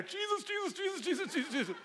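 high, soft, rapid pleading; mock terror in turbulence, begging under the breath; whimpering "Jesus" on a bumpy flight; panicked prayer. Shown as a demonstration. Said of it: "and I'm there".

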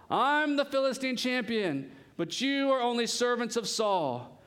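high, drawn-out champion's boast; swaggering warrior calling out; shouted taunt. Acted. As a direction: proclaim yourself scornfully to the enemy ranks. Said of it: "stood and shouted a taunt across".

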